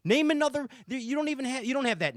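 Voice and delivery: high, loud, fired-up challenge. Real, not acted. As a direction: dare them to name one. Challenging the guests.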